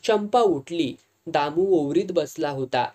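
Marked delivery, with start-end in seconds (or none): none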